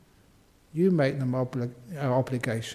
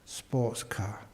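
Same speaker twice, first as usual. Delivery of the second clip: normal talking voice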